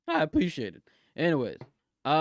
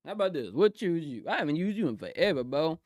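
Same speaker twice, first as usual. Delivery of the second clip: ordinary delivery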